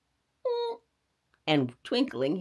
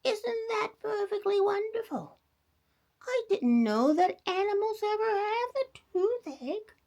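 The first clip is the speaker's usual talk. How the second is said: put-on much higher old-lady voice; old grandma; 14 semitones higher than usual. A narrator's reading. Grandma marvels at the toothache rabbit, high old voice. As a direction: marvel like a sweet granny.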